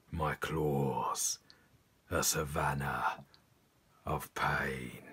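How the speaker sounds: very low, slow, rumbling lion. Shown as a demonstration. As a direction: speak as a proud lion, each phrase drawn out and deep. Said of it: "in a lion voice".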